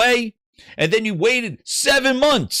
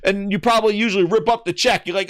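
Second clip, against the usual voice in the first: ordinary talk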